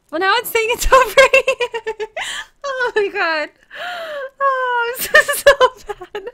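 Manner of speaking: cry-laugh through the words; real cry-laugh at her own confusion. Real, not acted. Map keeps moving, cracking up.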